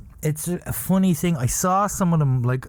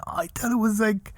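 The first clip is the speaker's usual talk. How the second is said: giggly whisper; 6 semitones higher than usual. Real, not acted. Giggling confession.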